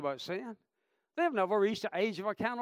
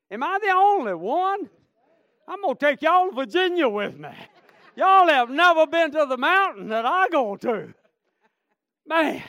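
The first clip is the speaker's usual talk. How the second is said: loud, high revival shout; fervent pulpit cry; full-force preaching; 8 semitones higher, 11 dB louder than usual. Real, not acted. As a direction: preach it high and hard to the crowd.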